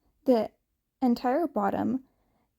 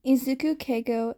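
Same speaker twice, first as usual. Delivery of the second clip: ordinary delivery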